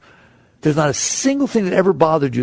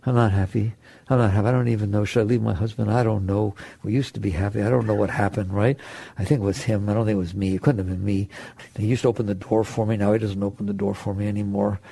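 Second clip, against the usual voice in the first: much lower and softer, fading despair; a dejected wife doubting her marriage, growing quieter; miserable inner voice; 8 semitones lower, 6 dB quieter, faster than usual. Retold or imitated. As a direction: mope through a tired, unhappy monologue. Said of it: "That's not you talking in there".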